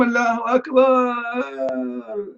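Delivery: mock call of Allahu Akbar. Mocking Muslims who scream in the mosque, a drawn-out high cry. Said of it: "why you scream".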